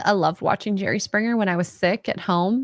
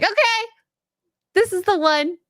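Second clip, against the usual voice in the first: excited; 12 semitones higher than usual. Retold or imitated. Mock excited dress pick.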